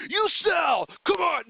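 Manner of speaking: high, loud, pushy dad yelling; egging her on in a hammed-up yell; retelling urging his daughter, a playful mock shout. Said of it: "I was like".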